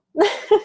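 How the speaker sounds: squeaky burst of laughter; high laugh. Real, not acted. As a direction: laugh in disbelief. Refusing the vials.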